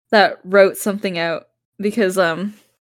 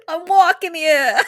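hammed-up New York accent, a little higher; shitty New York accent; 6 semitones higher than usual. Comic quoting a New Yorker on the street, bad New York accent. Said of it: "a really shitty New York accent".

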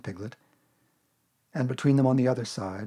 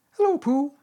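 loud, very high little squeak; 8 dB louder than usual. A narrator's reading. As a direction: squeak it out like an eager little one. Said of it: "he squeaked".

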